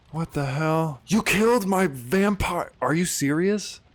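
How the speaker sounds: high, loud, drawn-out outrage; outraged. Real, not acted. Gamer whose favourite vampire was just killed, outraged. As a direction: howl in disbelief at the loss.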